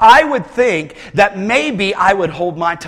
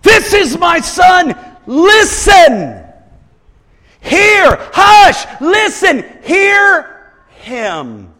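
high, loud, slow commanding shouts; strong command; 11 semitones higher, 7 dB louder, much slower than usual. Retold or imitated. God commanding the disciples, slow loud shouts. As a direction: hammer each command home. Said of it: "it's the strength".

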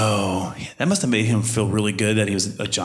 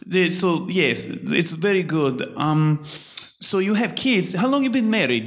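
high, halting mock Russian accent; drawn-out Russian-accented small talk; older Russian woman; 7 semitones higher, slower than usual. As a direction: imitate a prying Russian barber, pitched high. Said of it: "this older Russian, obviously Russian woman".

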